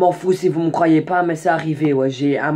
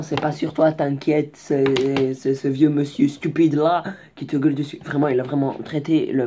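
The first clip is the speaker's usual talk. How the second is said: ordinary delivery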